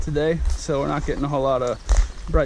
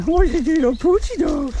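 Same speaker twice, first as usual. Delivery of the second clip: doggy voice; 12 semitones higher, 3 dB louder than usual. Real, not acted. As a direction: coo up high like talking to a puppy. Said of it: "in a voice like that".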